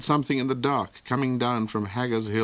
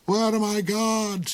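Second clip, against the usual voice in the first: slow croaked question; croaking; 11 semitones higher than usual. A narrator's reading. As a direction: croak it out slowly. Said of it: "he croaked".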